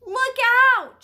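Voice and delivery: high, loud warning; shout. A narrator's reading. Woman warns the boy near fighting dogs, shouting. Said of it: "shouted the woman".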